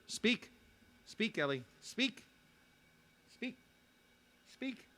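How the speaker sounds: soft, high-pitched pet command; high, soft pet-talk urging; coaxing a dog. Real, not acted. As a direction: urge the dog on in a high, small voice. Coaxing his dog to speak.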